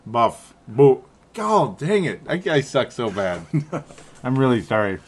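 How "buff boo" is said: "Buff" like the English word, then a broken-off "boo"; failed pronunciation attempt. Shown as a demonstration. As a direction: fumble between "Buff" and "boo". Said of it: "I still can't do it".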